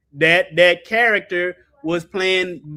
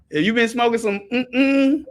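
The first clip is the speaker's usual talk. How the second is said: ordinary talk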